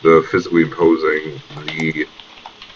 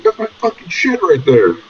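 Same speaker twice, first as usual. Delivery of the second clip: indignant protest; 11 semitones higher, 4 dB louder than usual. Acted. Jim protesting at Stamp.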